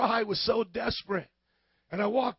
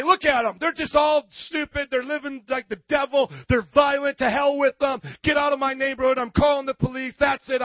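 loud, raised, indignant outburst; fed-up angry rant; 5 semitones higher, 5 dB louder than usual. Acted. Churchgoer writing off local teenagers, fed-up angry rant. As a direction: rant like a neighbor who has had enough.

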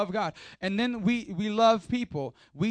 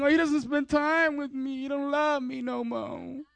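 mock complaining; 6 semitones higher than usual. Preacher mimicking a neglected girlfriend, mock complaining. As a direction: whine it like a neglected partner, slowing at the end. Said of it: "somebody complaining".

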